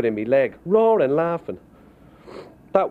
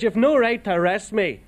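put-on drunken protest; 10 semitones higher than usual. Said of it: "when you have a few drinks in your skin".

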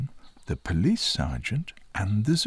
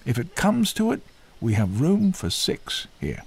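high, louder keeper voice offering reassurance; helpful zookeeper, pitched well up; reassuring zookeeper; 7 semitones higher, 4 dB louder than usual. A narrator's reading. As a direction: buck him up with a raised, hearty delivery.

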